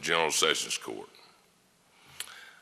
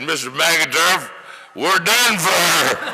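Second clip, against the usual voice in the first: slow, much higher, louder, laughing through the words; can barely get it out for laughing; laughing retelling; 11 semitones higher, 8 dB louder, slower than usual. Retold or imitated. Young man telling the old lawyer they've lost, laughing.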